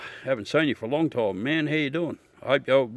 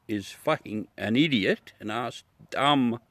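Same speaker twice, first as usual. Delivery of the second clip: usual speaking voice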